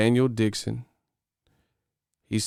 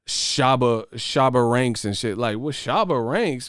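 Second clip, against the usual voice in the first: ordinary talk, nothing marked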